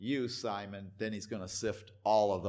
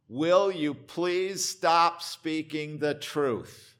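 louder, higher, drawn-out plea to stop; pressing demand; 4 semitones higher, 5 dB louder, slower than usual. Acted. Authorities pressuring Jesus to stop speaking, slow insistent demand. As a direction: press it slowly and forcefully.

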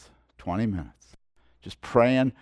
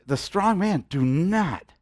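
ordinary talk

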